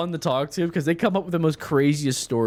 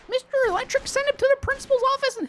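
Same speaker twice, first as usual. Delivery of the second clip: put-on whiny kid voice. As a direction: squeak it out like a tattling kid. Mocking a whiny middle schooler.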